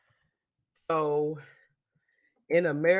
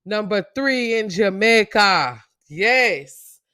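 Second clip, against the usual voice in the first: a playful, self-mocking Jamaican accent, a little higher; poor Jamaican accent; 5 semitones higher, 8 dB louder, slower than usual. Podcast host greeting Jamaican listeners in a poor Jamaican accent. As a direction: lay on a rough Jamaican lilt. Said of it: "it's my poor Jamaican accent".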